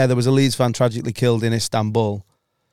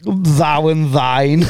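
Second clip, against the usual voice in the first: Thou and thine drawn out very slowly, relishing each word; savoring the words; much slower than usual. Shown as a demonstration. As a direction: roll "Thou and thine" slowly for comic effect. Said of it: "I just think the word thine is funny".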